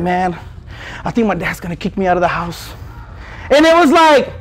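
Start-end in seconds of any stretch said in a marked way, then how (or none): 0.0-2.7 a friend admitting bad news, low and soft; hushed worried friend; quiet low confiding. Friend in the circle telling the group about his dad, low and hushed.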